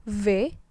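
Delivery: "V" as "vé" with a thick v; French hard V. Shown as a demonstration. Teacher repeating V for students, firmly pressed sound. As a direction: lean on the v of "V", not f. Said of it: "ditekan tebal".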